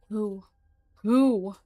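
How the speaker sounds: demanding, voice rising to a shout. Acted. Mullins demands answers of the creature, shouting. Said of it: "Mullins begins to raise her voice".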